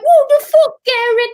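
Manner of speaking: loud, very high mock outrage; hammed-up yell of an exasperated star at the director; Russell Crowe. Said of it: "Russell Crowe was like".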